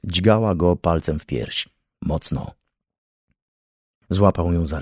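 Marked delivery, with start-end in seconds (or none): none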